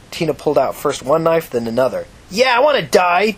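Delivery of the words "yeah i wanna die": loud, high, slowed defiant scream; screaming back. Acted. Tina screaming back at the prostitute, defiant loud scream. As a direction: throw it right back in her face. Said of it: "Tina screamed back".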